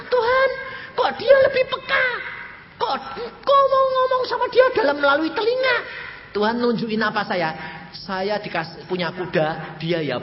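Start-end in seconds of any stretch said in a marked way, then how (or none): 0.0-5.8 high, plaintive question to God; high, wounded asking why; pleading complaint. Retold or imitated. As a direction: whine to God about it.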